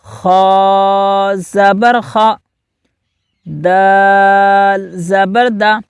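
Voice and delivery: very slow, deliberate letter-by-letter chant; slow recitation drill. Shown as a demonstration. Teacher leading pupils in slow drill. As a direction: recite each syllable for the class to repeat. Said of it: "آپ بھی میرے ساتھ دہرائیں".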